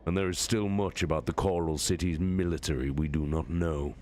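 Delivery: lightly voiced warlord, steady and measured; a hint of a commanding general; Horus giving orders. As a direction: issue the battle plan with calm authority. Horus assesses defenses.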